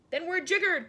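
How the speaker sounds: high loud exasperated cry; cried out. A narrator's reading. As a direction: throw up your hands and yell. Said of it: "cried the Army General".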